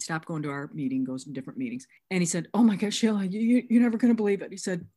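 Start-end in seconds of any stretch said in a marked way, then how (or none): 2.5-4.5 louder, higher breathless-news delivery; imitating an amazed caller; excited disbelief. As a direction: act out someone bursting with news. Acting out excited phone call.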